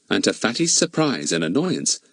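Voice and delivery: ordinary delivery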